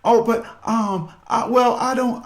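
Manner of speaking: mock the husband cracking as he fumbles; husband breaking down in front of his wife, high and halting; about to cry. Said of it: "he starts breaking down to cry".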